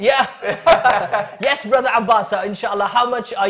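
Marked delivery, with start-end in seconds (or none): 0.3-1.4 loud embarrassed laugh; bashful laugh. Real, not acted. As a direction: laugh it off, flattered. Laughs after compliment on voice.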